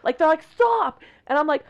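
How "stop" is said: high, loud imitation of an angry child; mock kid demanding a halt; serious, pissed-off kid. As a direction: protest like an exasperated child. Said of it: "how fucking serious they're being".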